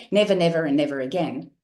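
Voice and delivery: "Never, never, and never again" rattled off in one breath; no pauses between the "never"s; run-on, no cadence. Shown as a demonstration. Coach showing how Mandela didn't say it, run together. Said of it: "He didn't say".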